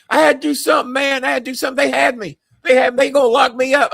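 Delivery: high pleading Adrian; whiny excuse. Retold or imitated. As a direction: make excuses in a panic.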